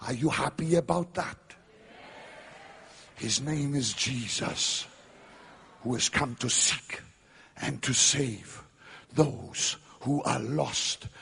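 ordinary talk, nothing marked

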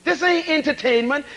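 ordinary talk, nothing marked

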